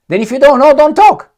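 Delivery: exasperated outburst. Real, not acted. Scolding Trump's vague answer.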